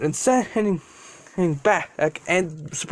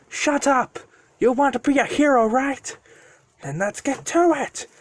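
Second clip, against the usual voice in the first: higher-pitched dragon voice, scolding; bossy dragon; 8 semitones higher than usual. Acted. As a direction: order the kid into action, pitched up. Dragon scolding Deku in story.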